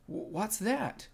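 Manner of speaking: high, slow, stammered question; startled. A narrator's reading. Piglet hears a whistle mid-excuse, startled and high. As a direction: catch on the first sound, then ask high.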